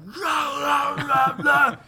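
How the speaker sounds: loud, high snarling dog imitation; mock rabid dog roar. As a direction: bark it out like a crazed rabid dog. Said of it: "and just full on, like".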